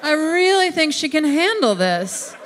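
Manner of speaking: hammed-up God overruling the angels; God insisting in a high voice; insistent God. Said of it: "And God was like".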